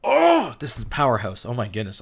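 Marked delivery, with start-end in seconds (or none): 0.0-0.6 very high, loud, thrilled "oh"; amped-up outburst. Real, not acted. Preacher carried away after reading scripture aloud, amped-up outburst. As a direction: let the excitement burst out in one loud cry. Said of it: "I'm getting way too amped".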